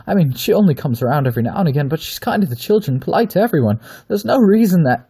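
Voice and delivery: fast, high and loud begging that climbs; panicked babbling. Acted. Aver babbles on, begging the wolf, rising panic. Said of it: "he kept babbling on".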